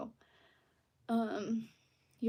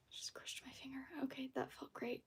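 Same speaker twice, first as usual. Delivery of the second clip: whispering; 3 semitones higher, 13 dB quieter than usual. Real, not acted. Just hurt her finger.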